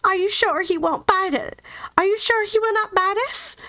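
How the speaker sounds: high, timid little mouse queen. A narrator's reading. Queen peeks from the grass to ask about Toto, timidly. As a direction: ask nervously, pitched well up. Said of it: "in a timid voice".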